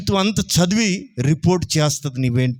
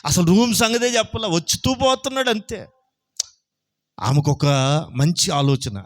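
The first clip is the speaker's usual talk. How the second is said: normal talking voice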